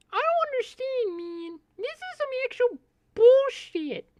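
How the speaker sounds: very high squeaky mock outrage; goofy falsetto rant. Streamer griping at a broken setup, goofy falsetto rant. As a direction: complain in a silly, piercing falsetto.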